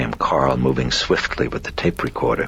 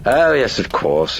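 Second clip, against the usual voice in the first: high, loud, gleeful agreement; amused; 10 semitones higher, 6 dB louder than usual. Acted. Possessing personality answers priest's bargain, high amused crowing. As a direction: crow it with amusement. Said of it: "it responded with a cackle of amusement".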